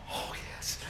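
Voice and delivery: breathy hushed delight; whispered yes. Acted. God overjoyed, whispered yes. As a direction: whisper it in awe.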